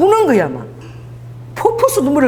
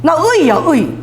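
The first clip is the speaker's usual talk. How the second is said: higher, louder Busan-style hail; Busan dialect; 6 semitones higher, 5 dB louder, slower than usual. Acted. Preacher echoes father's nickname for her, Busan dialect. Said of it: "부산 사투리 썼어요".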